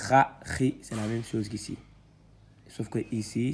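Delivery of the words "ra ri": loud, drawn-out syllables read one by one; syllable reading drill. Shown as a demonstration. Teacher spelling out syllables loudly for students. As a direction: sound out each letter for the class.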